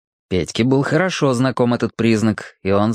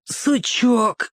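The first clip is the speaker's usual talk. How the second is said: put-on higher boyish answer; boy's voice; 11 semitones higher than usual. A narrator's reading. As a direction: voice Borka, short reply. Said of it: "ответил Борька". (мальчишеский голос, коротко)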